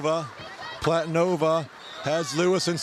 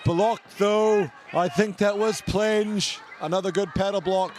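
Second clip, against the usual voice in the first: raised, lively match commentary; sports commentator calling the game; live play-by-play; 7 semitones higher than usual. Acted. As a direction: call the action as it happens. Live canoe polo commentary.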